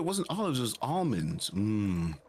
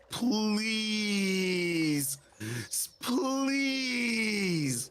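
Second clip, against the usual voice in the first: mock wail; 10 semitones higher, much slower than usual. Mock wailing plea.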